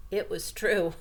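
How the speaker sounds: plain reading voice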